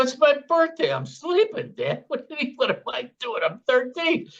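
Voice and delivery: put-on high-pitched protesting kid woken on his birthday; whiny sleepy teenager. Son woken by dad on birthday, high whiny protest. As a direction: play the groggy thirteen-year-old complaining to dad.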